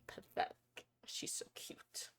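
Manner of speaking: soft whisper, slow; doting whisper over the pet. Real, not acted. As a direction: breathe it out fondly.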